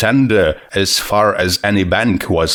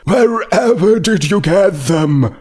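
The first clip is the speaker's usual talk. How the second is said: excited exclaim; 9 semitones higher than usual. A narrator's reading. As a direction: gasp out the word radishes in wonder. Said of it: "zombie stallion voiced excitedly".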